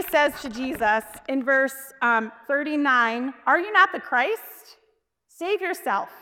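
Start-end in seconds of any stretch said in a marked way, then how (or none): 3.5-6.1 higher, slow mock challenge. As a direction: taunt lightly, pitch raised. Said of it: "starts mocking the Lord".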